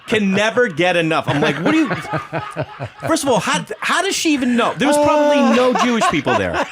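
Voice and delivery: cracking up mid-story; real laughter spilling through; laughing hard. Real, not acted. Cracking up at the aunt.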